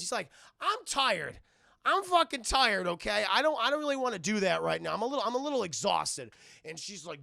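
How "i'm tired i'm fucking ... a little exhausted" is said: loud mock whine, high then settling; whining complaint. Puck begging off Demona's order, loud tired whine. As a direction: protest like a worn-out, put-upon helper.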